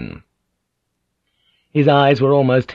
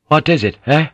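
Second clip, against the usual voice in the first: higher, edged challenge; 6 semitones higher, 4 dB louder than usual. A narrator's reading. Omi answers Blackthorn's beckoning, edged. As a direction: ask it with a hard edge. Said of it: "Omi's voice was edged".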